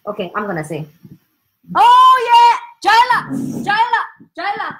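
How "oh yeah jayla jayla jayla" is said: high, loud whoop of delight; excited cheer. Real, not acted. Teacher cheering Jayla for the right answer, excited. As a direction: cheer the name over and over, high and thrilled.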